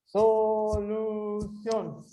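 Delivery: "Solução" stretched very slowly, each syllable held; sustained, syllable-by-syllable "Solução"; separated into syllables. Shown as a demonstration. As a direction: draw out so... lu... ção in "Solução". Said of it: "separar em sílabos".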